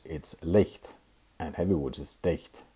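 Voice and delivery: ordinary talk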